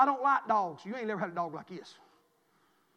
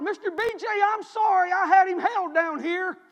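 raised-pitch called-out apology; imitated child calling from afar; little boy hollering; 9 semitones higher, 10 dB louder than usual. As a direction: holler it up like the neighbor kid. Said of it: "the little boy hollered".

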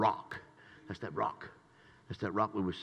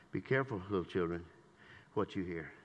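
ordinary talk, nothing marked

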